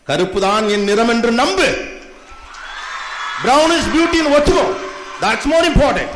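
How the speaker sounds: loud, slow proclamation; rallying shout. Real, not acted. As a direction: declare it to the whole hall, drawn out. Rallying cry to the audience.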